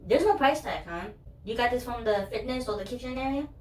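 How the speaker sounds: chirpy cashier asking across the counter; imitating a curious store clerk; nosy cashier. Cashier asks about price tag.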